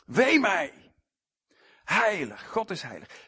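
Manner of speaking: high, loud cry of woe; crying out like Isaiah. Acted. Worshipper crying out to the holy God, loud lament. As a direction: call Wee mij out in dismay. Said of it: "roept wat Jesaja roept".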